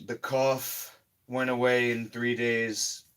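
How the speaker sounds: ordinary delivery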